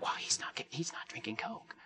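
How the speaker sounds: put-on hushed whisper of awed kids; mock awed kids' whisper; children whispering. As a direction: whisper it like a child in the back row. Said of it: "It's like".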